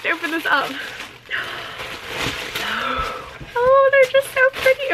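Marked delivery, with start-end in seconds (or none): 1.3-3.6 gasp. Real, not acted. Opening the shoe box. 3.6-4.9 much higher, squealing with delight. Real, not acted. Squealing to viewers at the first sight of the new boots.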